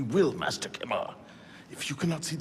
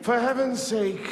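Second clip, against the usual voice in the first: exasperated; 10 semitones higher, 4 dB louder, slower than usual. Acted. Mirabeau exasperated by blindfold.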